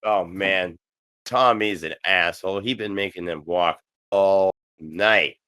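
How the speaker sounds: ordinary delivery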